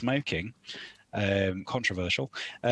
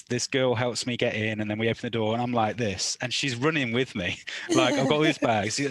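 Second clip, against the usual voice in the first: ordinary talk, nothing marked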